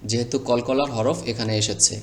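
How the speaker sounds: ordinary delivery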